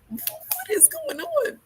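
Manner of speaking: very high, slow mock disbelief; high, stretched-out incredulous question; retold bewilderment. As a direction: squeak it slowly, imitating someone baffled. Bewildered brother acted out.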